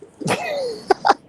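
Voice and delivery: high-pitched laugh. Real, not acted. Caller cracking up at the host, high-pitched laugh. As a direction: laugh high before speaking.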